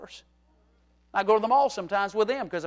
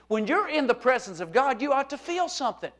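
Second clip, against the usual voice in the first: ordinary talk, nothing marked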